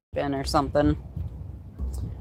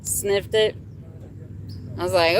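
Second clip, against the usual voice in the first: ordinary delivery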